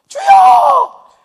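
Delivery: very high, drawn-out loud cry; desperate cry. Acted. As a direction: wail the word out in panic. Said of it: "신하는 똥줄이 땡겨가".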